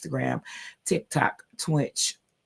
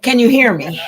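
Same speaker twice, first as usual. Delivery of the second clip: calling out loudly; 8 semitones higher, 11 dB louder than usual. Real, not acted. Checking the mic, calling out.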